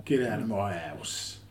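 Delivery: slow, low mock Cockney growl; a bad Cockney take on the line; Cockney accent. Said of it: "He's not a Cockney".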